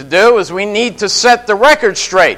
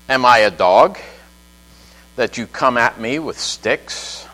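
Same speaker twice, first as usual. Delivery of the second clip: much lower and softer, a scornful giant; mock Goliath; 9 semitones lower, 6 dB quieter than usual. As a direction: sneer it low like a mocking giant. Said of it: "He said".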